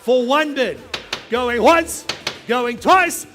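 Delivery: loud going once, going twice; auctioneer. Acted. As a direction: call it out to the whole room. Final calls before hammer.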